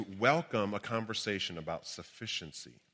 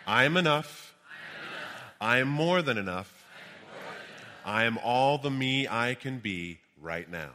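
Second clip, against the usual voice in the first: booming motivational speech. Shown as a demonstration. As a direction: lead the room in affirmations. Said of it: "Repeat after me".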